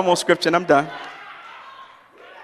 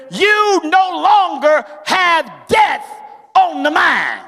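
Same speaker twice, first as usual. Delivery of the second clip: full preacher's cry, pressing the point home; preaching at full force; 7 semitones higher than usual. Acted. Preacher assuring the congregation Christians need not fear death, full-force preaching. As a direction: lift it high and ring it out like a pulpit climax.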